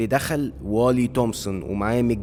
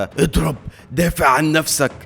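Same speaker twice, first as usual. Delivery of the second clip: higher, louder yell from outside the ring; urgent shout; 5 semitones higher, 6 dB louder than usual. A narrator's reading. Jack yells at the giant from outside the ring, screaming. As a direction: shout urgent orders to a fighter. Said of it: "جاك بيصرخ من بره الحلبة".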